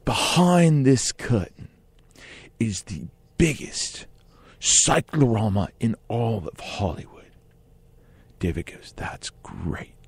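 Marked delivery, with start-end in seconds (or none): 0.0-7.3 slow, drawn-out sales pitch, lightly voiced; mildly imitating a grand director; director's pitch. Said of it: "And the director goes". 9.0-9.9 soft whisper, almost no voice; whispered reply. Retold or imitated. [name] answers quietly.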